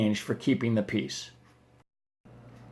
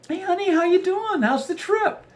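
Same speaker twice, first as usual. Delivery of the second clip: high, cheery put-on wife voice. Wife calling husband in Vegas, high cheery voice. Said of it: "Your wife calls".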